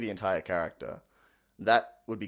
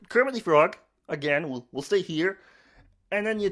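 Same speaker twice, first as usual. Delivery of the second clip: higher, louder froggy Kermit; 7 semitones higher, 5 dB louder than usual. Shown as a demonstration. Demoing Kermit before Miss Piggy, froggy. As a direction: croak out the name as Kermit. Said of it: "you go".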